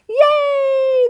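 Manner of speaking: high, drawn-out squealed cheer; crowd cheering. Acted. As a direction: celebrate as a tiny crowd. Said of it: "crowd cheered".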